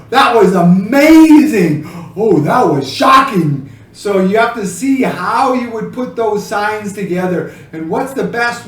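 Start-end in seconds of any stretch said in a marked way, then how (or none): 0.1-3.7 loud, laughing "That was amazing"; amazed exclamation. Shown as a demonstration. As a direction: burst out in delighted amazement. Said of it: "it was like".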